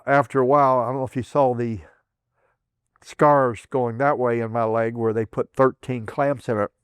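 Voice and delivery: ordinary talk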